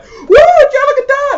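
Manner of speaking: high, loud, stretched-out fan whoop; mock fan cheer. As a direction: ape a gushing crowd, high and drawn out. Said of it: "everyone's like".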